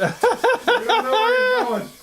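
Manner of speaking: high, slow, laughing. Real, not acted. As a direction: laugh through the words. Laughing at stray drone.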